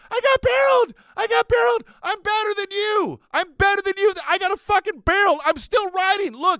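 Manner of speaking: high, loud triumphant yelling; gloating shout. Retold or imitated. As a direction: shout it in the kid's face.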